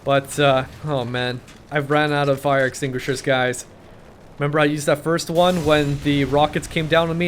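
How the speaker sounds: normal talking voice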